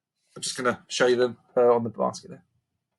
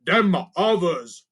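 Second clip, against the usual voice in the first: higher goblin, slow fake agreement; playing along; 5 semitones higher, 5 dB louder, slower than usual. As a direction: cover the slip quickly. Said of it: "trying to play along".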